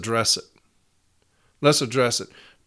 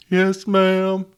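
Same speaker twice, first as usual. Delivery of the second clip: put-on higher, small voice of a boy who's just been whipped; meek child reply; 6 semitones higher, 3 dB louder than usual. Son answering his mom after the beating, meek and higher. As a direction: squeak out a cowed yes ma'am.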